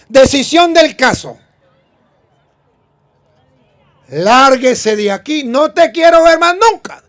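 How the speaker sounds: yelling judge kicking someone off the show; TV judge angry verdict. Retold or imitated. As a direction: throw her out like a furious TV judge. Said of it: "¿El de caso cerrado?".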